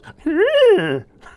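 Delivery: very high, loud giggle; Doughboy giggle. Retold or imitated. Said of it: "and he goes".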